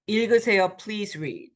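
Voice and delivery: ordinary delivery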